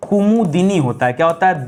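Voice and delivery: ordinary talk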